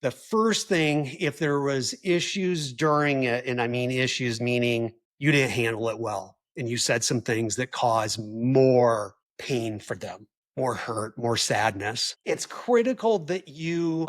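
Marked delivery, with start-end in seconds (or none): none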